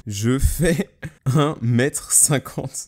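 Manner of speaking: slow, loud, word by word; spaced-out typing voice; slow dictation. Real, not acted. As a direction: say it slowly as you type. Dictates message while typing it.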